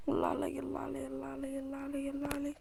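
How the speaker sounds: very soft, repetitive murmur; hushed self-praise. Real, not acted. Player praising herself while concentrating, hushed murmur. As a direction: murmur it under your breath.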